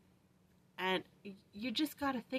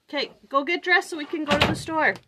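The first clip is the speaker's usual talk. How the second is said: calling out; 8 semitones higher, 12 dB louder than usual. Real, not acted. Calling out to kids.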